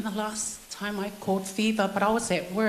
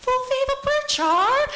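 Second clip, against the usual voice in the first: cheeky Palangi voice; 7 dB louder than usual. Retold or imitated. Prankster teasing mother-in-law one more time, cheeky Palangi voice. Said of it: "I thought I'd be cheeky one more time".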